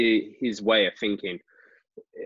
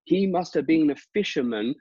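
high, frantic imitation of a baffled punter; pitched-up mock bewilderment; mad theories; 9 semitones higher, 4 dB louder than usual. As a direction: rattle off crazy theories in a squeaky voice. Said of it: "all he was going on about was like".

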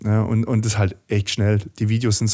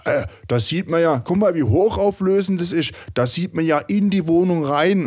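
higher-pitched mock colleague voice; imitating the boomer colleague; 6 semitones higher than usual. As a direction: play the worried older coworker, pitched up. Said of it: "er gemeint".

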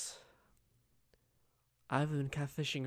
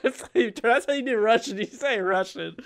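louder, through real laughter; laughing; 8 semitones higher, 12 dB louder than usual. Real, not acted. Player cracking up over the Russian accent. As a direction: laugh while explaining.

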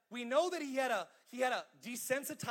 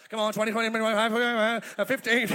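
fast-talking auctioneer bidding down; 8 dB louder than usual. Retold or imitated. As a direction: call numbers like an auction. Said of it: "like, an auctioneer".

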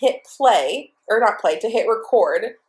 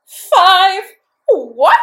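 loud, high-pitched incredulous outburst; stunned disbelief; 10 semitones higher, 10 dB louder than usual. Retold or imitated. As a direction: replay the shock, voice leaping up. Acting out shock at queue number.

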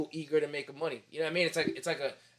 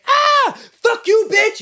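high-pitched, loud yell through an apartment wall; screaming neighbour; 11 dB louder, much slower than usual. Acted. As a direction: shriek it like someone mid-fight. Imitates arguing neighbours heard in hallway.

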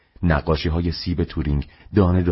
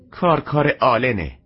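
exasperated outburst; 8 semitones higher than usual. Acted. Mother blames son amid chaos.

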